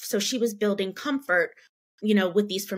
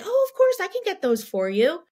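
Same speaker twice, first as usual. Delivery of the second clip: much higher, louder, gushing; cheery server; 10 semitones higher, 4 dB louder than usual. Retold or imitated. Server Julie happily agreeing to fetch toys, high and gushing. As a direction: sweep up high like a friendly waitress.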